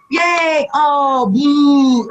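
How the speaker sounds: high mock crowd cheer then boo; crowd reaction. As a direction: imitate the fans cheering then booing. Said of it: "a simple reaction".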